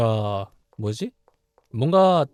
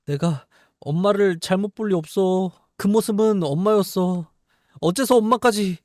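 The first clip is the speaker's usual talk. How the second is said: higher, louder distressed daughter; acted heroine dialogue; 7 semitones higher, 5 dB louder than usual. Heroine refusing to believe her mother is cursed, higher and louder. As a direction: plead that mother would never do this.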